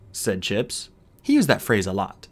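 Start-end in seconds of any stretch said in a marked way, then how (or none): none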